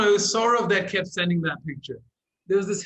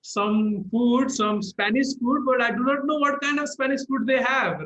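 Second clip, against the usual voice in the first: lightly put-on Indian accent, quick and a bit higher; imitating an Indian caller ordering on the phone; a hint of an Indian accent; 3 semitones higher than usual. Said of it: "in the Indian accent".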